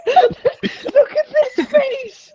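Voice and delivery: high squeal through laughter; laughing. Real, not acted. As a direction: laugh it out, slowly. Laughing at a character face.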